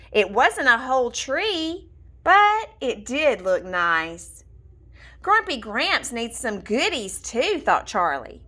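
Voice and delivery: ordinary delivery, nothing marked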